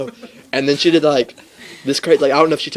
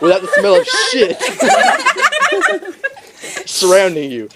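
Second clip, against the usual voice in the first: laughing through; 7 semitones higher than usual. Retold or imitated. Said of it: "She's like".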